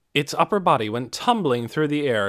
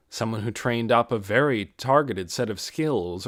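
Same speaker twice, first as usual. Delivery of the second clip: ordinary delivery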